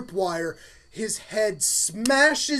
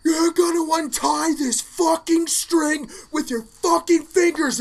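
high, loud furious scolding; angry dad; 9 semitones higher, 6 dB louder than usual. Retold or imitated. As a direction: chew the kid out, seething. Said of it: "And he's like".

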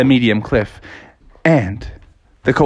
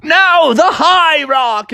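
high, loud rallying yell; acted excited yell; 12 semitones higher, 4 dB louder, much slower than usual. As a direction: call it out to the whole gang, drawn out. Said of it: "Bucky yelled out".

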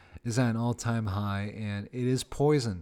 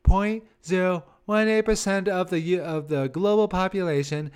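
higher put-on expert voice; mock science recital; 7 semitones higher, 5 dB louder than usual. Comic reciting googled science to livestream critics, mock science recital. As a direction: recite a statistic in an affected high voice. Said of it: "I recited the science back to them".

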